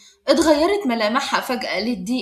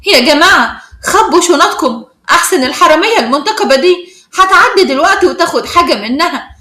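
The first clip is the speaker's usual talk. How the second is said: raised, higher voice calling out to the crowd; loud taunt; 3 semitones higher, 8 dB louder than usual. A narrator's reading. Schoolgirl warns the crowd about the supposed thief, loud and taunting. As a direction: announce it loudly for everyone around to hear. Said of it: "بنت كانت بتقول بصوت عالي".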